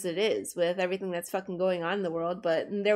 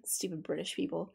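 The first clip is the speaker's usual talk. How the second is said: ordinary talk, nothing marked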